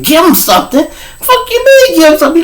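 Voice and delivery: incredulous. Retold or imitated. Incredulous retort.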